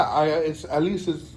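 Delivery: ordinary talk, nothing marked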